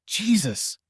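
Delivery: high, pained exclamation; betrayed husband crying out at his wife; anguished cry. Acted. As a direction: cry out as if struck. Husband breaking down.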